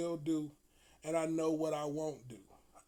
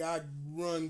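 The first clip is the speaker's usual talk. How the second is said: ordinary talk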